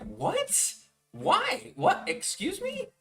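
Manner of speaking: very high, stunned squeals of confusion; shocked disbelief. Retold or imitated. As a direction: recoil in outraged surprise at a stranger. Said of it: "And I'm like".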